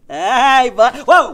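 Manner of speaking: loud, high startled yell; playful shout. Real, not acted. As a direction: shout it out, caught off guard.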